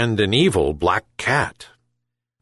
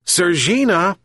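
shouted call; 9 semitones higher than usual. A narrator's reading. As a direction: call the cat from afar, high. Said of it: "shouted the old fisherman to his cat".

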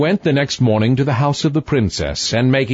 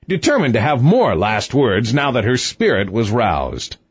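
usual speaking voice

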